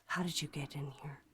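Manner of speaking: imitating a low, whispery monotone. Neighbor questions someone unseen in the room, monotone. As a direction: ask it very softly, without inflection. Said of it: "his voice was all of a sudden monotone".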